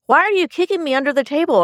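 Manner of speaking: high-pitched mock bewilderment; puzzled, clueless question. Wife retelling her husband's blurted question at dinner, puzzled. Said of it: "being very literal just said".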